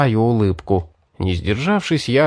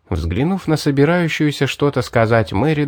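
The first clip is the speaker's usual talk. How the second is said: plain reading voice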